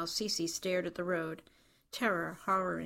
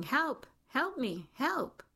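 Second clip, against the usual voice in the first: mock yelling; 8 semitones higher, much slower than usual. A narrator's reading. Woman in a wheelchair calling to a nurse, restrained yelling. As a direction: call out for help in a restrained, high yell. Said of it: "waving one hand in the air and yelling".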